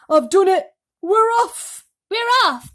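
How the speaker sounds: very loud, high triumphant shout. A narrator's reading. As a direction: yell it out, we're off at last.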